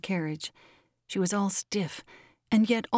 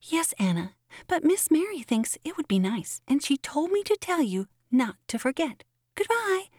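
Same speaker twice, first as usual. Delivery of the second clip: put-on high, lilting child; child's high voice, sing-out goodbye; little girl's voice; 8 semitones higher than usual. A narrator's reading. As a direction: chirp it as a small girl passing on orders. Little girl answers Anna in story.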